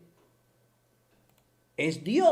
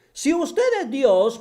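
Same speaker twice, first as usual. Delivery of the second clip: usual speaking voice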